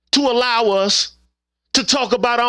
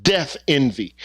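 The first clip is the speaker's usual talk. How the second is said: usual speaking voice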